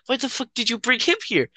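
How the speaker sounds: put-on girl's outraged quote. Retelling the girl who was caught topless, high mock outrage. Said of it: "She's like".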